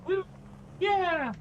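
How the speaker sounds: high-pitched triumphant cheer; big excited high cheer; celebrating. Real, not acted. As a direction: whoop with delight at the win. Celebrating success in the game.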